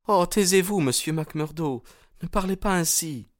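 put-on high-pitched girl cutting a suitor short. A narrator's reading. Young woman tells her suitor to stop, high protesting voice.

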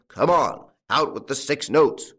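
bully them into handing it over; angry farmer's loud growl; growled demand. A narrator's reading. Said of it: "growled the farmer".

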